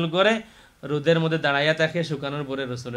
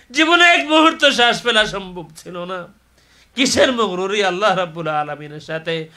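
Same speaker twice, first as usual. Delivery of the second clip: high, very loud fervent cry to God; full-force preaching; 10 semitones higher, 12 dB louder than usual. Real, not acted. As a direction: build to an impassioned shout.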